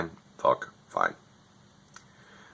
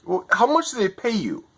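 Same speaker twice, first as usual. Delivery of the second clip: high, pitched-up furious rant; 3 dB louder than usual. Retold or imitated. Yelling at the tech agent who couldn't fix his internet, furious. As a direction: screech at the useless agent in disbelief. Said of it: "And I was so furious".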